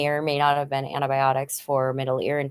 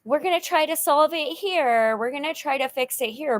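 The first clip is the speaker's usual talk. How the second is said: put-on much higher, brightly reassuring to the kids; higher cheerful comforting voice; forced reassurance; 8 semitones higher than usual. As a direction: put on a hopeful front for the children. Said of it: "you just try to be like".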